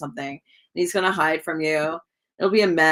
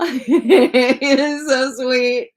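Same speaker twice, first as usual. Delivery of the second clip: slow, louder laughing gush; 6 semitones higher, 7 dB louder, much slower than usual. Real, not acted. Tarot reader gushing over the sweet guy on the card, laughing.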